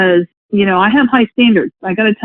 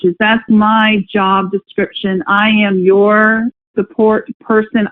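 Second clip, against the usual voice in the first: ordinary delivery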